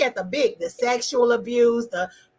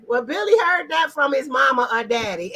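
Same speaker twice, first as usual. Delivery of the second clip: normal talking voice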